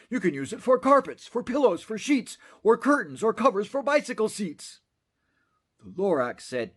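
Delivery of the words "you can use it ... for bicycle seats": higher, quick salesman's list; rattling off uses. Acted. Onceler listing thneed uses to the Lorax, quick and high. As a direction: rattle them off to dazzle.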